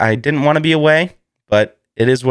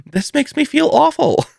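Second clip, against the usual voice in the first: mock distress; 14 semitones higher than usual. Mock guilt over eating a duckling.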